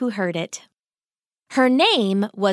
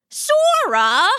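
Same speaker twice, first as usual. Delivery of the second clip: high, louder rising disbelief; incredulous echo of the name; shocked; 10 semitones higher, 4 dB louder than usual. Acted. Father hears the suitor's name.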